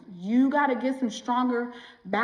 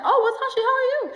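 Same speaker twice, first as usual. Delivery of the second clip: high, sing-song fake friendliness; mock sweet friend; 13 semitones higher, 4 dB louder, much faster than usual. Imitating a self-centred friend with a high, sing-song hello. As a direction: toss it up high like a too-sweet greeting. Mimicking friend who only dumps.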